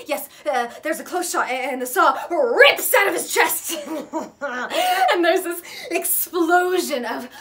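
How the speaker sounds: loud, high, gleeful rush; frenzied horror retelling. Acted. Retelling a film's bloody climax, loud and frantic. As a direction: get carried away by the gore.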